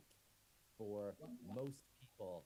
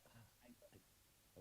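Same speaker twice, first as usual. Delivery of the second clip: breathy whisper, slow; whispered musing; 17 dB quieter, much slower than usual. Acted. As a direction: whisper it to yourself, puzzled. Pushy guy wonders to himself.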